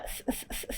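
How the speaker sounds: a long voiceless whistling hiss. Shown as a demonstration. Teacher demonstrates the whistle of the letter to her student. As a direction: let the air whistle through the teeth, no voice. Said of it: "س-، یہ آواز آئے".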